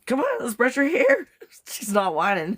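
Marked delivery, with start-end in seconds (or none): 0.1-1.3 coaxing pet voice. Real, not acted. Coaxing the dog.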